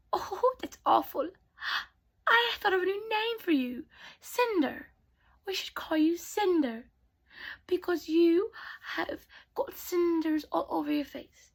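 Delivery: high, put-on teasing voice; mocking stepsister.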